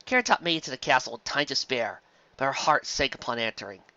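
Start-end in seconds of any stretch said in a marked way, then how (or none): none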